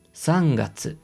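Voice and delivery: Japanese "sangatsu", pitch going downward; falling Japanese pitch. Shown as a demonstration. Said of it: "Se va para abajo".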